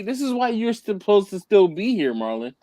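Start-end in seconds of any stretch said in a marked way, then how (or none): none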